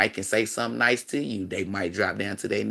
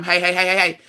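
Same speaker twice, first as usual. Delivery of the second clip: put-on sharp, loud, higher hey to halt the kids; calling out to stop; 7 semitones higher, 5 dB louder than usual. Mom calling her playing kids to stop them. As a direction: cut the kids off with a firm call.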